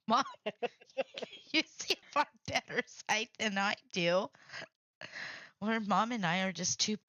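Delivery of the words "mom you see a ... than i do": retold comeback broken by giggles; laughing retort. Daughter retelling her answer to mom, laughing. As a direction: giggle through a teasing protest.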